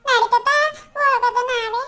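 put-on high, pleading little kid tugging at grandpa; whiny child voice. Grandchild begging grandpa to leave, whiny child voice. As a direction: beg like a small child who wants to go now.

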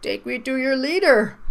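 high alien robot voice; weird megaphone voice. Acted. Fairy flies over the vowel in the word, weird high voice. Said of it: "her voice was all weird".

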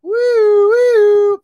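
high, loud "woo" whoops; celebratory whoop. Real, not acted. Card breaker celebrating a big pull, high excited whooping. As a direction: whoop it up like a siren.

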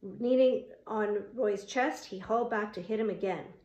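plain reading voice

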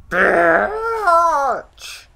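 very high, loud, stretched-out shriek; piercing drawn-out yell; screamed insult. Real, not acted. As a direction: scream it and hold the vowel long. Screams at game attacker.

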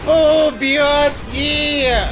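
high, loud battle whoops; triumphant shout. Real, not acted. Player landing blows on the Reaper, triumphant shouting. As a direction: punch out each hit gleefully loud. Lands hits on game boss.

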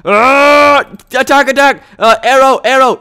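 loud, high attack cries; urgent high shouting; frantic battle yell. Real, not acted. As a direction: yell commands mid-fight. Frantic fight in game.

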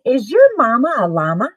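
ordinary delivery

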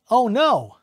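cartoon dub exclamation, pushed loud and high; alarmed anime line. Shown as a demonstration. Acts out a scripted anime line.

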